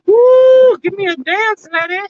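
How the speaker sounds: squealing falsetto, loud on the woo; playful falsetto squeal at the plants; high squeaky voice. Pretend.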